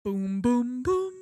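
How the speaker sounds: loud imitated chime climbing in pitch; booming intercom chime. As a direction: boom out three rising chime notes. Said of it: "this was a different level".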